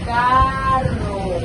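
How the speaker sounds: carro drawn out and spoken loud; slow reading. Shown as a demonstration. Teacher reading carro slowly for the class.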